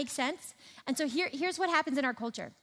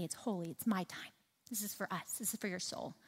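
softer, lower, intimate God voice; tender; 5 semitones lower, 9 dB quieter than usual. Retold or imitated. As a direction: speak it gently like a loving invitation. God's tender words voiced softly.

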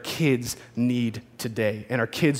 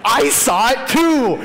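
high, loud, slow delighted cry; gleeful grin; 8 semitones higher, 5 dB louder, slower than usual. Retold or imitated. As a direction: beam like a kid who saw it too. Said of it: "grinning from ear to ear like".

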